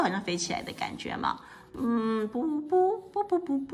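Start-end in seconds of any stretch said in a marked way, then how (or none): 2.3-3.8 higher puffed puh-puh like a tuba; tuba imitation. Shown as a demonstration. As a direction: blow it out like a little horn. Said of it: "怎么吹呀".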